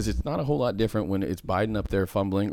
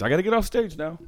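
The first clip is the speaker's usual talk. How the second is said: mock Biden, hurried; 8 semitones higher than usual. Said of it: "get him off the fucking stage".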